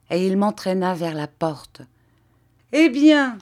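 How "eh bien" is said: loud, high, gleeful jibe; Garofoli, laughing tone. A narrator's reading. Padrone gloating as the master leaves, laughing tone. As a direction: toss it out in high amusement. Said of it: "dit Garofoli en riant".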